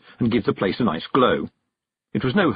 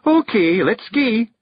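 high, loud Scots voice; bearded stranger, impatient; 12 semitones higher, 4 dB louder, slower than usual. A narrator's reading. Hidden voice telling the carter to set off, high Scots. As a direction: give the off in high Scottish brogue.

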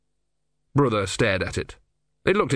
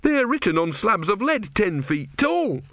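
higher, earnest novice insisting; young novice, insistent; 11 semitones higher than usual. A narrator's reading. Novice voiced by narrator.

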